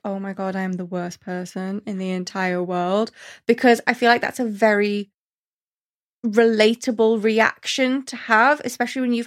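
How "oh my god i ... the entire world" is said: much softer, low guilty moan; guilty Rapunzel. Retold or imitated. Rapunzel guilty about disappointing Mother Gothel, soft moan. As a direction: sink into guilt, quietly. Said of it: "And she's like".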